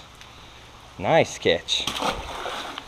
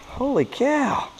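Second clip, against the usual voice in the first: amazed; 13 semitones higher, 5 dB quieter, slower than usual. Real, not acted. Sees a big bass.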